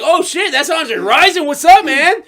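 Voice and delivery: starstruck, thrilled shout-out; excited fan. Pretend. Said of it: "I'm like".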